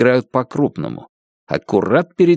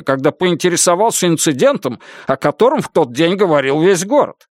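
higher-pitched, badgering questioner; insistent prosecutor, voice pitched up; pressing interrogator; 7 semitones higher than usual. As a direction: pile question on question at the witness.

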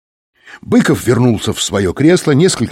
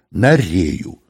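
usual speaking voice

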